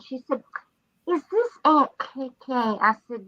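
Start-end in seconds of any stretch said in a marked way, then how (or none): 1.1-2.5 little niece. Retold or imitated. Voicing small niece.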